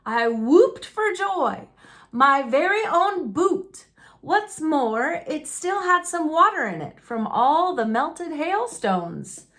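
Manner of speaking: plain reading voice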